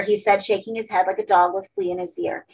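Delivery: ordinary delivery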